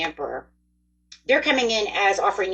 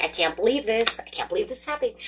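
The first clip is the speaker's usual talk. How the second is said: high-pitched, hushed giddy disbelief; a secret thrill kept inside your head; giddy inner voice; 4 semitones higher, faster than usual. Acted. Reader voicing Capricorn's private thoughts, giddy and high. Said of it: "giddy in your head".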